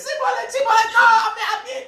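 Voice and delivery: very high, comic frantic stammer; flustered squeaky panic. Acted. Captive keeps failing the guards' password test, squeaky panic. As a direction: keep correcting yourself in a squeaky panic.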